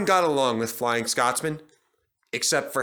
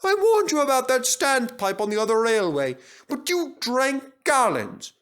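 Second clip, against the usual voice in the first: much higher, slowing on gallons; high little engine telling him off; chiding Duck; 12 semitones higher, 3 dB louder than usual. A narrator's reading. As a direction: scold him kindly, high. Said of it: "put in Duck".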